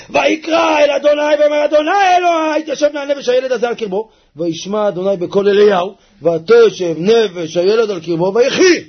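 loud, high declaiming of scripture; fiery sermon reading; preaching a verse. Acted. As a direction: cry the verse out to the crowd. Preacher reads verse at full force.

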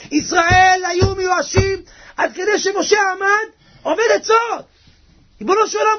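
high, urgent sermon cry; fervent preaching. Real, not acted. Preaches at full force.

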